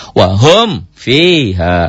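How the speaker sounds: slow, each sound of "Wahum fiha" kept distinct; clear izhar drill. Shown as a demonstration. Teacher drilling students on clear mim before fa. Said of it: "Latihannya begitu".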